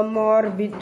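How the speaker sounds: ordinary talk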